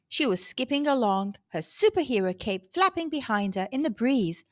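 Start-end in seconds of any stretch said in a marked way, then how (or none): none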